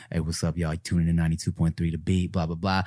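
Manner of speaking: deep smooth radio host. Retold or imitated. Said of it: "sexy, deep ass".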